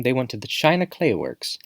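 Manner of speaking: ordinary delivery, nothing marked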